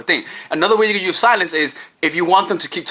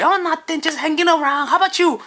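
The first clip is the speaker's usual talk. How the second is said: much higher, chirpy answer; eager girl; 11 semitones higher than usual. Acted. Woman answering the guy's question, high and eager.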